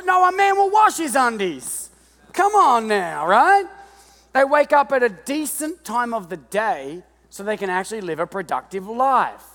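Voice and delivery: loud, high, driving sermon delivery; revved-up preacher shouting to the crowd; preaching at full force. Real, not acted. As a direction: preach it hard to the room.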